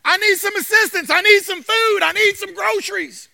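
loud, high calls for help; pleading shout. Retold or imitated. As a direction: cry out your need to God. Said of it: "she shouted".

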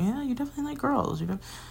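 teasing insistence. Retold or imitated. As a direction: rush it like friends ganging up. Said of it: "And we were like".

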